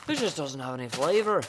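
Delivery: a vague made-up accent on It just doesn't have any flavor; unplaced accent. Shown as a demonstration. Repeating her chalk complaint to a friend in a vague made-up accent. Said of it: "I don't know what accent that was".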